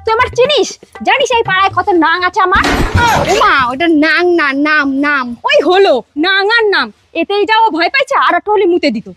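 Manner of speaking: loud bossy aunt. Acted. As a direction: shout it like a tough neighbourhood aunt. Tough aunt scolding boy.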